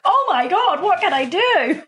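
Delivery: high, bursting "oh my God" squeal; excited gush. Retold or imitated. Telling a friend she wants to help, high excited gush. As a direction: gush like a thrilled volunteer.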